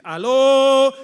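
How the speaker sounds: loud call to the crowd. Real, not acted. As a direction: shout it out to wake them up. Preacher calls out to congregation. (llamado fuerte al público)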